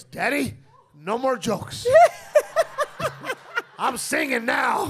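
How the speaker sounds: put-on high, loud giddy child voice broken by laughter; little boy. Son tells his daddy he's done with jokes, high and laughing. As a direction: announce it proudly like a four-year-old.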